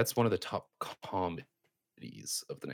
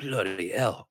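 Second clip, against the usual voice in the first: ordinary delivery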